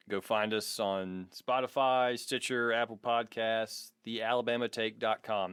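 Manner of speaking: ordinary delivery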